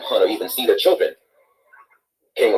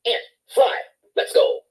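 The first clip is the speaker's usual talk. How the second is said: calling out; slower than usual. A narrator's reading. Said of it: "Anansi called out".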